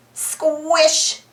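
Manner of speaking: usual speaking voice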